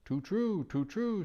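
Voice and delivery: high, sorrowful owl voice, drawn out; sad owl. Acted. Owl grieving the departed king, sadly. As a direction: sigh it like a mournful owl. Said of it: "said the owl sadly".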